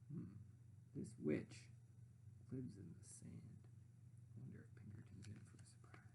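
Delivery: fast whispered aside; hint at a secret, hushed. A narrator's reading. Teacher aside to listeners.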